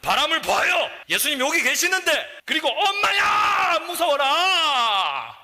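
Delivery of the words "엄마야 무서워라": high, drawn-out frightened shout; mock panic. Preacher acting Peter shrieking in fright. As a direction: cry out like a terrified Peter sinking.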